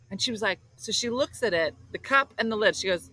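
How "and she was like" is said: ordinary talk, nothing marked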